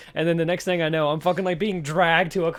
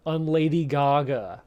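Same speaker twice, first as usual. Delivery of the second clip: stunned repeat; much slower than usual. Real, not acted. Slow stunned repetition.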